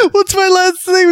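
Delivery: high panicked question; freaking out. Retold or imitated. Retelling the crewman's freakout, high panicked question. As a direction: ask it in rising panic. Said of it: "freaking out".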